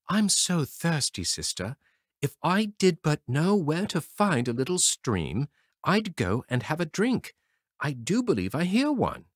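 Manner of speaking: lightly voiced thirsty boy; a hint of a pleading little boy; small brother begging for water; young brother. A narrator's reading. Brother character speaks in story.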